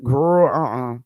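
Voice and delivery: a different monkey waving it away; mock girlfriend shutting it down; second monkey, dismissive. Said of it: "they could be talking like this".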